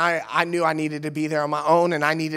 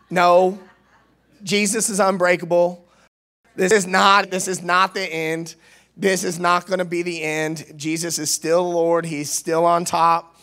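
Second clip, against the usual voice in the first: preaching. Real, not acted. Preaching answer to despair.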